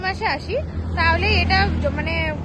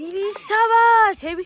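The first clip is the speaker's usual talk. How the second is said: echo shout; 3 semitones higher, 6 dB louder, much slower than usual. Real, not acted. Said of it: "কি ভালো ইকো হচ্ছে না?".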